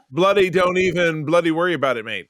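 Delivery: breezy Aussie mate telling you not to worry; mock Australian. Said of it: "he'll say".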